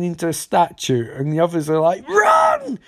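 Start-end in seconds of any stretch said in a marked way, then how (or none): 2.1-2.8 a high panicked yell of Run; panicked shout. Retold or imitated. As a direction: scream it as you flee. Mobsters flee shouting.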